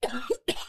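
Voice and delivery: kurzer trockener Huster; Husten. Real, not acted. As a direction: einmal kräftig husten. (trockenes Husten)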